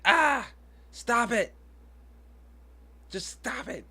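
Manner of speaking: high, loud "stop it" that fades at the end; mock sharp scolding. Mom mocking how she scolds her daughter, high and loud. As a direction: scold the child, half-acted. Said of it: "a little bit louder than that, a little bit sterner than that".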